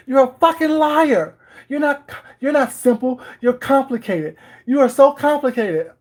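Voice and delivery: high, loud mock of the accusing girl. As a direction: replay the girl's outburst high. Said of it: "I'm like".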